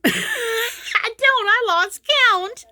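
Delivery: high, helpless, laughing protest; laughter pushing the voice up high. Real, not acted. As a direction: give up in real giggles. Laughing over endless reschedules.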